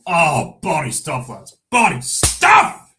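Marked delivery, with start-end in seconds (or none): 1.7-2.9 loud, high, drawn-out cheer; hammed-up shout. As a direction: belt out "stuff" long and high. Hammed-up repeat of a catchphrase.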